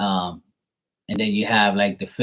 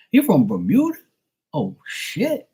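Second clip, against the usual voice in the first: much higher, astonished Georgia voice; imitated Georgia accent; 9 semitones higher than usual. Georgia local learning the visitor is from Bermuda, imitated accent. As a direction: pitch it way up like an amazed Georgia local. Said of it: "everybody there would be like".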